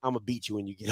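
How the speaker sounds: very low, quiet, imitated mother's threat; deadpan threat. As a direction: mimic mom's cold, understated warning. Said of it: "looks at me dead in my eyes and says".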